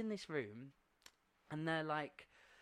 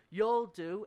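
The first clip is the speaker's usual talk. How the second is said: louder, higher villain's verdict; menacing villain; 6 semitones higher, 11 dB louder than usual. Retold or imitated. Scary man to the boy before caging him, loud villain voice. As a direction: act out the villain claiming his catch, loudly.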